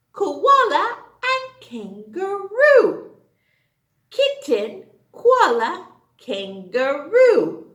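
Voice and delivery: sing-song high recital; children's poem recital. Acted. Teacher reciting the K animals poem, sing-song. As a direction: chant it for little kids.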